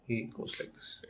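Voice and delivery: breathy secretive whisper, almost no voice. Retold or imitated. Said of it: "you don't have to whisper in my ears".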